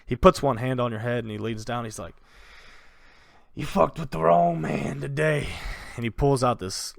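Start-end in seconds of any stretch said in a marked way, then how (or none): 3.6-5.5 somewhat higher menacing threat; bandit king. Acted. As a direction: lean in close and threaten.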